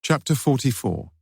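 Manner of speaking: plain reading voice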